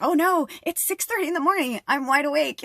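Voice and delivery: high, put-on exasperated outburst; mock frustration. Woken partner at six thirty, frustrated mock outburst. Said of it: "getting frustrated. And I'm like".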